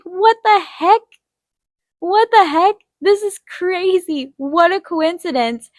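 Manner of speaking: very high, incredulous exclaiming; retold giddy astonishment; amazed. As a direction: exclaim in high-pitched, stretched-out disbelief.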